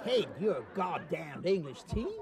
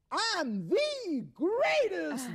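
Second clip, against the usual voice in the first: put-on much higher, very slow "greatest", drawn out in an American accent; stress on greatest; 13 semitones higher, much slower than usual. A rodeo star corrects the host, stretching out "greatest" in an American accent. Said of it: "Not one of the greatest".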